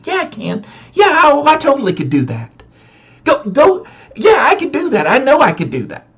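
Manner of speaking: put-on a light kid-brother voice, insisting; bratty high-pitched boast; high whiny kid insisting. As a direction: play the stubborn little boy.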